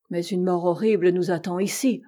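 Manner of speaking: usual speaking voice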